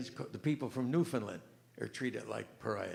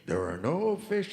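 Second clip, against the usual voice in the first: louder, slow booming announcement; big voice; 6 semitones higher, 8 dB louder, slower than usual. Retold or imitated. Joke's voice booming at the fisherman again. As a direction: boom it out slowly. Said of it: "the same voice".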